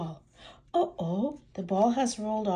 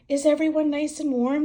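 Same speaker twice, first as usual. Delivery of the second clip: Daddy Pig voice; 8 semitones higher, 8 dB louder than usual. Acted.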